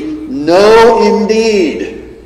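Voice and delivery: firm, decisive denial; emphatic no. Retold or imitated. Preacher rendering Jesus' emphatic answer, forceful. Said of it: "one way of translating it would be".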